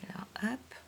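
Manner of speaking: whispered little hop, no voice. Real, not acted. Teacher whispers hop while marking the answer. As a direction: breathe the word hop as you tick it off. Quick aside while writing.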